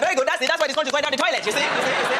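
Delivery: lightly voiced Nigerian-style approval; approving bus passengers. Passengers approving of the mother's discipline, mock approving voice. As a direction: agree like a righteous onlooker.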